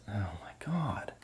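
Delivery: hushed, unvoiced exasperation; whispered aside. Real, not acted. Caught mid intro, he whispers exasperated: hushed aside. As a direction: breathe it out under the breath.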